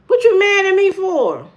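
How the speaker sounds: high, loud protesting question; loud, high-pitched objection; indignant protest. Real, not acted. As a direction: call out the viewer, affronted. Protests a viewer being mad.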